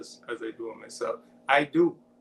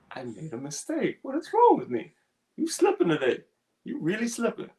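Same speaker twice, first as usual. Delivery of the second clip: scolding himself; 9 semitones higher than usual. Retold or imitated. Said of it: "I used to be so stuck in my feelings".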